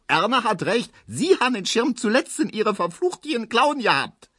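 very high, furious-sounding screech; sustained high Berlin scolding; screeching wife. A narrator's reading. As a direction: shriek the accusation at the old woman. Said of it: "kreischt Frau Ziebland".

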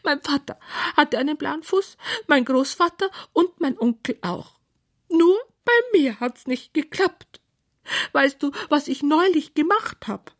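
high-pitched sorry knight; whining knight. A narrator's reading. Knight confessing his family shame to Clara, whining.

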